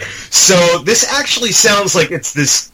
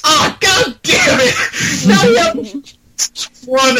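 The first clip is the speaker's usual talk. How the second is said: very high, drawn-out mock wail; girlfriend's yell. Imitating girlfriend reacting to the Xbox exclusive, high mock wail. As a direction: cry out in mock despair. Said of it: "made my girlfriend yell".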